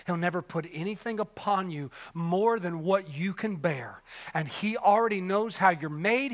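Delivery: usual speaking voice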